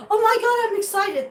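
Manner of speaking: high, squealing mock thrill; imitating someone bursting with excitement. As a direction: gush like an overexcited birdwatcher. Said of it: "when you get all like".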